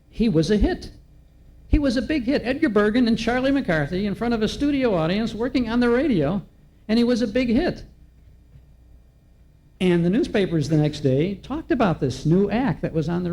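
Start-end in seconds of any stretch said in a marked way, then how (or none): 0.1-7.8 rising, delighted emphasis on the hit; excited. Real, not acted. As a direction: sell the triumph with a lifted voice. Host exclaiming Bergen's radio success.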